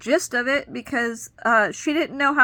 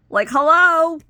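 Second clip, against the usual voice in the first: hello pitched up high and loud, dripping sarcasm; exasperated, mock-calling someone who isn't paying attention; sarcastic hello; 6 semitones higher, 8 dB louder, slower than usual. About the caseworker who never checked her license, a loud sarcastic hello.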